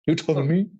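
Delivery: lightly mimicked movie gangster line; Robert De Niro. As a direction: toss out the famous challenge in a light impression. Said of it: "I love Robert De Niro".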